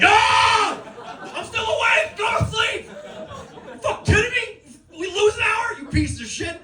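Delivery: high, yelled tirade; mock rage at birds. Sleepless comic yelling at early birds, high angry rant. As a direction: scream at the birds like a madman. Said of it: "I'm like".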